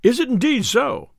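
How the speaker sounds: high-pitched eager question. A narrator's reading. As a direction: cry it out, hungry for news. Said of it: "cried the tinker eagerly".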